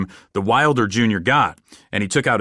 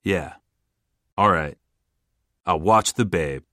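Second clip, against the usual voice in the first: low, understated reply with pauses; a hint of an odd smile; weird smile; 4 semitones lower than usual. A narrator's reading. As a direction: agree in a lowered voice, pausing between phrases. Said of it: "he gave a weird smile and said".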